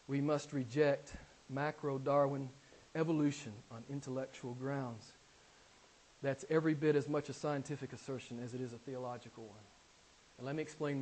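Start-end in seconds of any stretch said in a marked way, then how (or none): 0.1-5.1 softer, lower, settled repeat of the claim; quiet restatement. Shown as a demonstration. Preacher repeating his point to the congregation, now quiet and low. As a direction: drop the voice and let it sink in. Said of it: "Did you hear me?".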